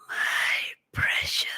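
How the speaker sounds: slow creepy whispered my precious; whispered Gollum impression with the ring. Gollum quote, ring on finger.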